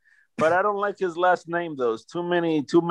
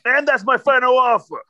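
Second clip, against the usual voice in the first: mock final offer; 6 semitones higher, 3 dB louder than usual. Running gag in GM voice.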